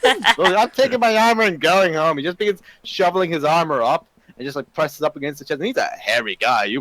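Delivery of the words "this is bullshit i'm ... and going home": high, loud sore-loser outburst, laughing through it; indignant rant. Acted. Sore loser quitting the poker game in a mock huff. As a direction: storm off in a huff. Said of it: "just throws it down like".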